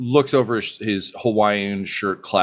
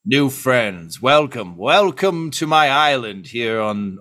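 showman host, arms spread; theatrical mad scientist welcome; grand welcome; 4 semitones higher, 3 dB louder, slower than usual. Acted. Mad doctor welcomes new friends to his island, theatrically.

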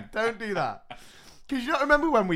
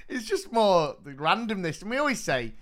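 normal talking voice

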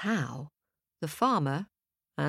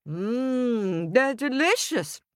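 lightly voiced wife savouring food, higher; pleased, higher-pitched savouring; delighted farmer's wife; 6 semitones higher, slower than usual. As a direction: relish it with a drawn-out mmm.

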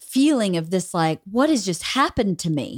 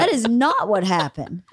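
normal talking voice

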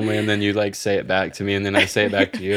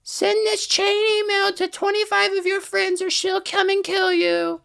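high put-on voice. Chain email spooky voice.

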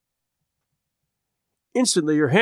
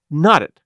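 louder, weighted KNOT IT; changed voice on last words; 6 dB louder than usual. A narrator's reading. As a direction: drop from flurried to pointed on "knot it". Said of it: "the change that came into her voice on that last".

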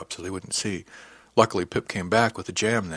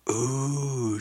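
appreciative; 5 semitones higher than usual. A narrator's reading. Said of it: "she said appreciatively".

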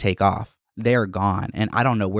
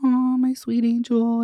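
cooing to her cat; 11 semitones higher than usual. Retold or imitated. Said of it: "I'm just like".

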